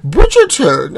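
loud, high gushing Bridgerton; excited cry. Acted. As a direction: burst out with the name. Excited announcement of show.

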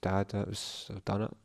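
soft, emotional murmur; choked-up words to dad. Real, not acted. Runner looking up to his late father, soft and choked. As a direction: say it softly to the sky.

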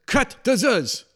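put-on high, loud accented outburst; hammed-up director barking to halt everything; Mel Brooks. Sound man imitating director stopping the playback, high loud impression. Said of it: "That's your Mel Brooks impression".

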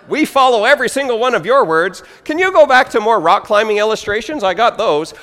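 fast, loud, high-pitched flattery; hammed-up, hurried enthusiasm; mock eager congregant. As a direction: gush it loudly in a rush.

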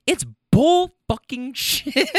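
high, slow mock outburst; retold outrage. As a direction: bounce her outrage back high and slow. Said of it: "she was like".

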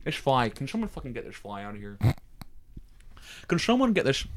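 softly tried celebrity impression; a hint of celebrity mimicry; Caitlyn Jenner. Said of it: "This is Caitlyn Jenner".

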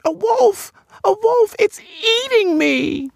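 very high, squealing alarm; terrified creature. Acted. Creature begs rescuer for help, high-pitched terror. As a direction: raise the alarm in a tiny panicked voice.

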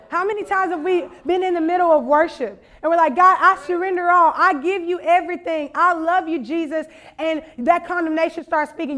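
fervent preacher's exhortation; impassioned preaching. Real, not acted. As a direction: drive the message home to the congregation. Preaches with rising force.

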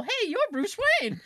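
high, loud excited exclamation; surprised recognition. Retold or imitated. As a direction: blurt out the name in surprise. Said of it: "This guy's gonna look at me and go".

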